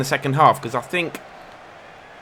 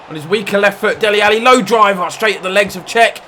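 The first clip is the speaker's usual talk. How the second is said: excited commentary; 7 semitones higher, 6 dB louder than usual. Real, not acted. Big chance, excited commentary.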